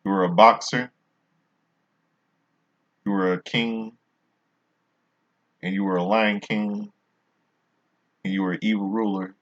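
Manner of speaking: usual speaking voice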